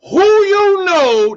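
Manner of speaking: high, loud and slow, almost crying out; loud drawn-out exasperated challenge; indignant yell. Real, not acted. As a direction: demand an answer in loud disbelief.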